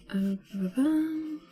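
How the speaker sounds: plain reading voice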